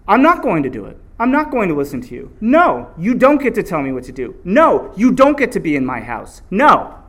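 emphatic mock defiance; firm refusal. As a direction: stand your ground, punching each refusal loudly. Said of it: "You just gotta say no".

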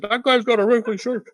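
catty bystander pointing him out; mock gossip. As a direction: sneer at the wrinkly shirt. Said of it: "I could just picture it".

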